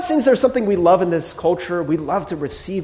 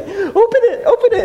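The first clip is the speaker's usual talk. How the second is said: high, excited coaxing; eager urging, much higher; 4 dB louder than usual. Retold or imitated. Excited giver urges friend to open the gift, high and eager. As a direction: bounce with eagerness.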